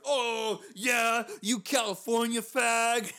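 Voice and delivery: hammed-up taunting comeback; mock shouted insult. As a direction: throw the insult like a trump card. Friend's retort in retold argument.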